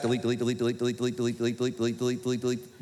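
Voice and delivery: monotone machine-gun repetition; fast, even patter; rapid repetitive deleting. Acted. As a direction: rattle the word off on one low note. Mimes erasing a text repeatedly.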